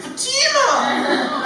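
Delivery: very high drawn-out cheery call of Fatima; teacher calling name. Retold or imitated. Teacher picking the partner, high slow cheerful Fatima. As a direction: sing out Fatima slowly, delighted. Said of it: "I'll pick the person".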